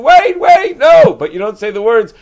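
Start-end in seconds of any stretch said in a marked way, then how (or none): 0.0-1.1 high, loud panicked yells of wait; frantic screaming. Acted. Husband chasing the messenger, screaming frantically. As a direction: scream after the messenger to stop. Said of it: "He's just screaming".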